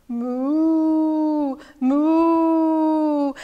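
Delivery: long, drawn-out imitated moos; cow mooing. Storyteller showing children what cows say, mooing. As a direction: moo steadily like a cow. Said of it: "What did the cows say".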